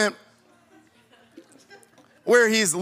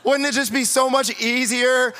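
gushing; 6 semitones higher than usual. Real, not acted. Dreamy wish for easy life.

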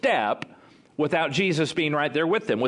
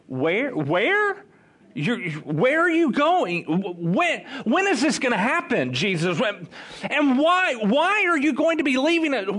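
mock-panicked, high-pitched stammering questions; acted freaking out; 7 semitones higher than usual. Disciples question Jesus about his leaving, in acted panic. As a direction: pile up bewildered questions, voice climbing. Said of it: "they begin to freak out".

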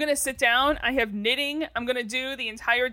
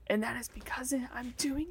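whispering; 11 dB quieter than usual. Real, not acted. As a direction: whisper it like a secret.

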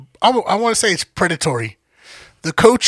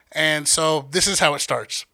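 ordinary talk

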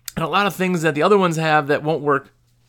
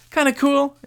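kinda cool said up high and bright; higher excited repeat; 9 semitones higher than usual. Real, not acted. Repeats phrase, second higher.

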